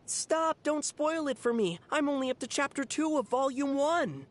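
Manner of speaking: high-pitched mock plea of a fan; mimicked fan. Imitating someone who butts in on fans talking, mock panic. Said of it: "Someone will butt in and say something like".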